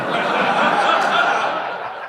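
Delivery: a breathy burst of laughter, pitch jumping up; amused laugh. Real, not acted. Recalling the pilot flying away, an amused laugh. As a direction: laugh it off, tickled.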